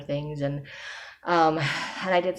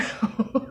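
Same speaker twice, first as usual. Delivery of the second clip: laugh; 7 semitones higher than usual. Real, not acted. Laughs about buying journal.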